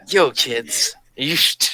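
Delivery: a light mock of the cool kid pushing drugs; "yo, kids" with a laugh, as the PSA bad boy; a hint of a nineties bad boy, chuckling. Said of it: "the bad boy in the nineties PSAs".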